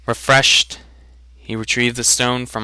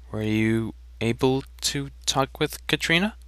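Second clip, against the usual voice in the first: much softer, hesitant asking; nervous question; 10 dB quieter than usual. A narrator's reading. Rowan nervously asking his brother about Katrina, softly. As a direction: ask it nervously, almost under your breath. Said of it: "Rowan soon asked nervously".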